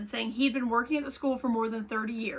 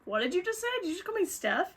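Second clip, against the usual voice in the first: higher-pitched, incredulous questioning; 7 semitones higher, faster than usual. Retold or imitated. Retold girlfriend called by the ex's name, incredulous. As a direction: imitate someone taken aback, voice pitched up.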